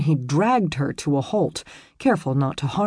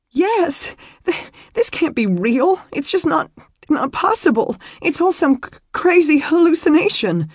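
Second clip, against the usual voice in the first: high, halting, tearful outburst; hysterical crying; 12 semitones higher, 5 dB louder than usual. A narrator's reading. Woman admitting to him why she ran, hysterical. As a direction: stumble and wail in disbelief.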